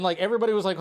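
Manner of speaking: ordinary talk, nothing marked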